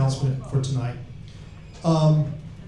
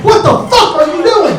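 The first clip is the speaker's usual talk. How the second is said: much higher, louder startled yell; shouted quote; 8 dB louder than usual. Retold or imitated. Comic acts someone startled, yelling high and loud. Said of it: "I'm right through the back like".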